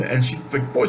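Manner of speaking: usual speaking voice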